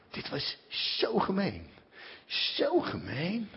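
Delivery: barely able to say how mean it was; crushed. Real, not acted. After reading the letter, hurt and slowing down.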